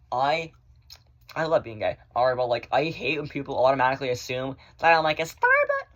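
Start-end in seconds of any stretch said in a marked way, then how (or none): 5.2-5.9 high squeaky mock voice; mocking falsetto. Mocking people who assume, in high falsetto.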